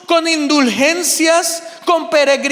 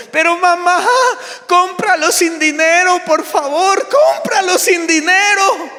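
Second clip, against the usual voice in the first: put-on higher, whiny little girl pleading; pleading child; 6 semitones higher than usual. Daughter begging her mother to pay without money, high whiny plea. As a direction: beg like a stubborn child.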